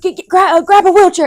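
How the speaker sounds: mock flustered nurse tripping over the word; flustered staff. Hospital staff caught off guard, stammering high and loud. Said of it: "they're, like, freaking out".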